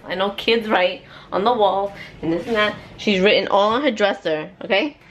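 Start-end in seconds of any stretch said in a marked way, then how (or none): none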